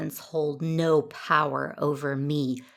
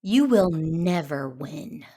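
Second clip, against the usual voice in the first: slow, louder defiance; grimly defiant in defeat; grim resolve; 2 semitones higher, 6 dB louder, much slower than usual. Acted. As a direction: say it slowly, refusing to surrender. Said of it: "grim resolve on her face".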